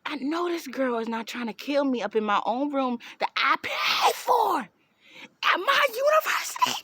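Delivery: rising to a high cracking cry of disbelief; indignant outcry. Retold or imitated. Telling the roommate she won't be attacked, crying out. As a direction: voice breaks up into a high wail. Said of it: "I said".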